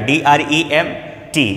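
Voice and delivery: letter by letter, very slow; slow spelling; spelled out slowly. Shown as a demonstration. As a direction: spell it out deliberately. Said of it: "dreamed भी होता है".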